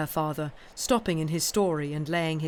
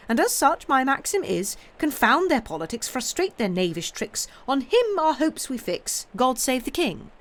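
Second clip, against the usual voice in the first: high and loud royal pride; proud patriot; 7 semitones higher, 4 dB louder than usual. Acted. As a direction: declaim the loyal motto. Patriotic declaration and motto.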